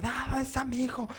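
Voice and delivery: high, slow mock panic; mother looking for her hiding son, in high mock anguish; imitating anguished mother. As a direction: play the frantic mom, half in jest. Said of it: "sale mi mamá así angustiadísima".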